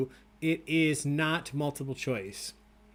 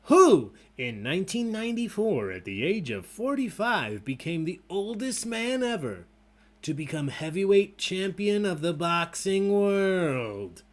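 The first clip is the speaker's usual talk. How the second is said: quiz question read; 5 semitones higher, 5 dB louder than usual. Acted. Host reads the boxing question to players, announcing grandly. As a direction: build the question up like a fight announcer.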